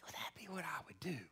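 quick unvoiced whisper. Real, not acted. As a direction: whisper the question to yourself, fast. Self-question, whispered.

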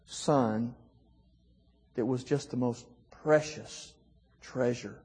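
fighting back tears, barely audible; choked up. Real, not acted. Father recalling nearly losing his son, tearful and hushed. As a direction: voice falling away, tearful and soft. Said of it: "I'm crying now as I tell it".

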